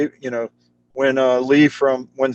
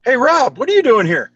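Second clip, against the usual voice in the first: high, bright surprised hello; retelling Jay's loud, happy surprise at running into him; excited greeting; 11 semitones higher, 4 dB louder than usual. Retold or imitated. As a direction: call out happily to an old friend. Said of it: "Jay goes".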